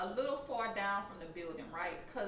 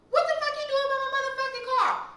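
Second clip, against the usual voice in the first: very high, loud holler; furious yell; 10 dB louder than usual. Retold or imitated. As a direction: yell across a car park at an intruder. Imitates someone yelling from afar.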